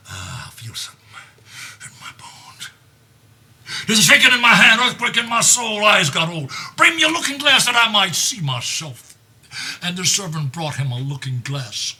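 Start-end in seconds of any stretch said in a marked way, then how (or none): 0.1-2.7 hushed, fading to a whisper; old king murmuring his fear; frail old king. Acted. Lear's frail aging lament. 3.9-9.1 high, loud cry of dread; king crying for a looking glass; anguished old king. Acted. Lear cries out in anguish.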